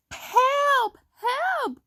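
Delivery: high cried-out plea; crying for help. A narrator's reading. Stuck little truck, crying for help. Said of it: "cried the little blue truck".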